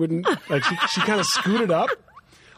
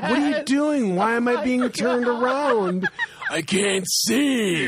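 raised, drawn-out mock distress; hammed-up dismay, slowing right down; mock panicked student; 6 semitones higher, slower than usual. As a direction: stretch it out like someone being spun helplessly. Acted student spun round in desk.

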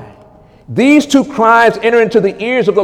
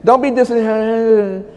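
usual speaking voice

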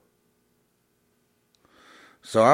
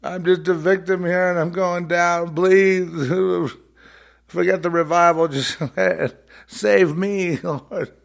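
high-pitched victim's wail; mock tearful begging; 10 semitones higher, 4 dB louder than usual. Imitating a victim begging the Lord, mock crying. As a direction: plead like a hammed-up sobbing victim. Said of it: "they're begging and begging and they're crying".

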